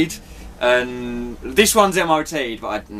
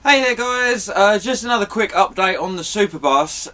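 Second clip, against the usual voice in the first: video intro; 7 semitones higher than usual. Acted. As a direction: greet viewers in a raised presenter voice. Another intro take.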